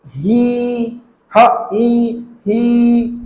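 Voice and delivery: ही with a long held ई, split into ह, ई; long vowel demo. Shown as a demonstration. Teacher showing a long sound, stretched and split. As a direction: hold ही long, split it, hold it again. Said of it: "long sound इसका".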